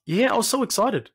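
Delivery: bright, lifted burst of joy; excited. Real, not acted. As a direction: let the excitement spill out. Recalling joy as a child.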